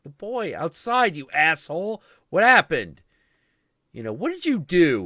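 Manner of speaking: high, loud furious yelling; mother yelling at Shiva over her son; furious shouting. Retold or imitated. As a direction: scream at him in rage.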